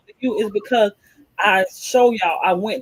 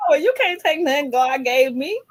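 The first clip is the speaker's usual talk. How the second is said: defiant retort; 4 semitones higher than usual. Retold or imitated. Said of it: "I said".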